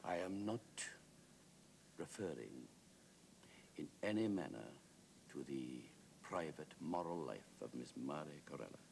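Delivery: solemn deadpan Wilde; very grave. Acted. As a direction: weigh each phrase gravely, halting between them. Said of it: "Wilde said very gravely".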